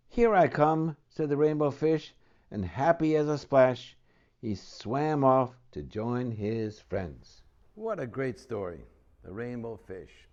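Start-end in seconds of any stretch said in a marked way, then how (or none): 0.1-7.4 lively storybook read-aloud; reading to children. Acted. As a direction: tell it to a child at bedtime. Reads picture book aloud.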